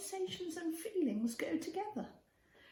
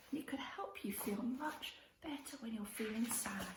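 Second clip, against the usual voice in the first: put-on soothing whisper to a child; soft whisper; 4 semitones lower, 5 dB quieter than usual. Teaching the butterfly hug.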